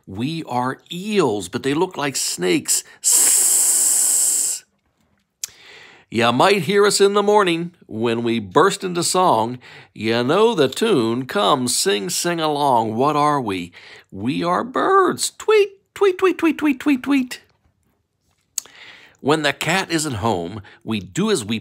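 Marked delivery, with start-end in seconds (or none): 3.0-4.6 snake hiss. Pretend. 15.3-17.4 very high, bright chirping tweets; bird tweets. Pretend. Bird calls in animal book.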